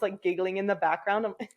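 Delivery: normal talking voice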